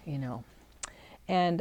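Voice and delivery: ordinary talk, nothing marked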